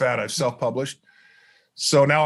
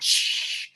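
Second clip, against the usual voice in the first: librarian shush. Retold or imitated. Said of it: "and the librarian went".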